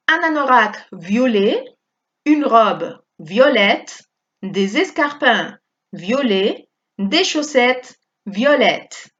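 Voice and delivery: slow, "violet" with silent t, "violette" with t; French pronunciation demo. Shown as a demonstration. Teacher modelling "violet" and "violette" phrases for the class. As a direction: say each phrase slowly for students to copy. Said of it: "Fijaos en este femenino que ha doblado la T".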